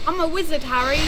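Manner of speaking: booming Hagrid line; Hagrid impression. Quoting Hagrid to Harry.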